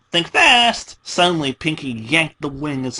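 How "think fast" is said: high, loud teasing warning; spring it on her like a game; playful taunt. Acted. Pinkie taunts Dash before yanking the wing, high and loud.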